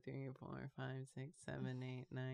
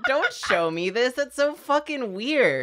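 disgusted outburst; 7 semitones higher, 5 dB louder than usual. Retold or imitated. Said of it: "And I'm like".

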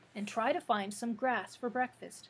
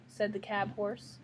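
plain reading voice

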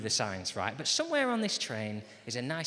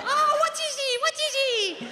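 put-on very high, loud Spanish kid squeal; excited Spanish child; 6 dB louder, slower than usual. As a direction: squeak it like an impatient little Spanish boy. Spanish child opening his present.

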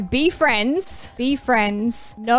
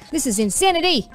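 ordinary talk

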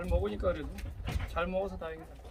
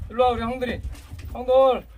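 high, loud call to the dogs; 6 semitones higher, 5 dB louder than usual. Real, not acted. Calling the dogs over.

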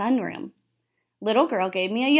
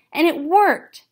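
triumphant; 8 semitones higher, 7 dB louder, slower than usual. Acted. Triumphant escape moment.

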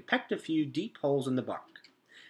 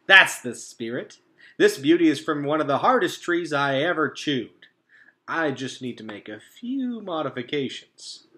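hearty beaver; 2 semitones higher, 8 dB louder than usual. A narrator's reading. Mister Beaver praising the robot, loud and hearty. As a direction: cheer her on like a burly craftsman.

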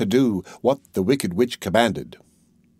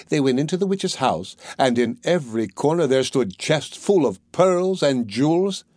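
ordinary delivery, nothing marked

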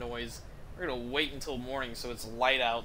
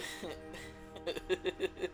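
high, softer giggling; real laughter; 5 semitones higher, 7 dB quieter than usual. Real, not acted. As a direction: giggle on helplessly. Still amused by bard's line.

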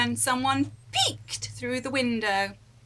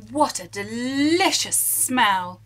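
ordinary delivery, nothing marked

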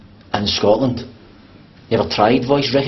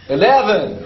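high, strained fake American accent; bad American accent; 8 semitones higher, slower than usual. Mocking the lift in fake American. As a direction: push the fake accent further. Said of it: "That sounds Irish, not American".

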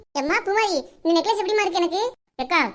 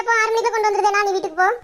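ordinary delivery

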